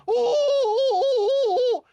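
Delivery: very high, loud excited hoots; puffed-up hooting like she's high on gas; mock hooting. Mocking Rachel Maddow with high, loud excited hoots. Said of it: "and she's like".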